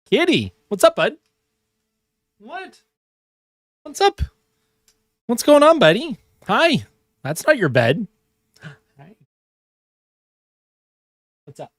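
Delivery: high sing-song voice for the cat; cutesy high pet voice; pet talk. Real, not acted. Talking to his cat.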